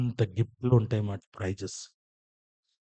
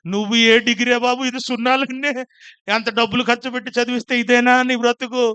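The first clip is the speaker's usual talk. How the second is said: exasperated father; 10 semitones higher, 6 dB louder than usual. Retold or imitated. Father exasperated at daughter's zeros.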